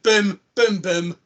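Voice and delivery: punchy imitated gunshots; quick-fire booms. Showing how quick the shootings were, quick-fire booms. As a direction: fire the booms off as sudden bursts. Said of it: "It was, like, that kinda quick".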